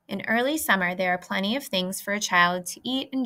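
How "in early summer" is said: plain reading voice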